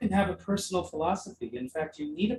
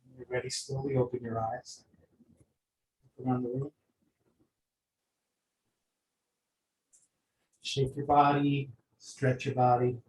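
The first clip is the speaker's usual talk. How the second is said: guided meditation; 4 semitones lower, 4 dB quieter than usual. Acted. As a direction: gently guide them back to the room.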